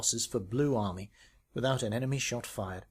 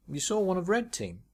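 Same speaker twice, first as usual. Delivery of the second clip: surprised question; 7 semitones higher than usual. Acted. Bill asks, surprised.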